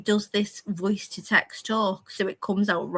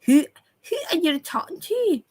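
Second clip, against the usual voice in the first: high-pitched Scots "who are you talking to?" with pursed lips; Scots accent; 7 semitones higher than usual. Shown as a demonstration. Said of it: "Pull your lips back".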